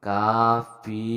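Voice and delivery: কাফি in two drawn-out syllables, long "aa" then long "ee"; long vowel demo. Shown as a demonstration. Teacher explaining alif and ye maruf, slow কাফি demo. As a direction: stretch both vowels of কাফি very slowly. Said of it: "ফে না বলে পি বলতেছে".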